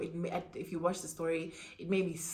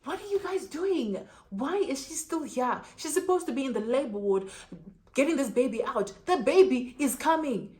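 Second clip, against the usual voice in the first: alarmed doctor; 6 semitones higher, 4 dB louder than usual. Retold or imitated. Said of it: "the doctor's like".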